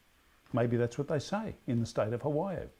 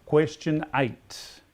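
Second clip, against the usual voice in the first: slow, loud question call; quizmaster announcement; 3 semitones higher, 6 dB louder, much slower than usual. Acted. Quizmaster calling the next question to the pub, slow and loud. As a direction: announce it slowly to the room.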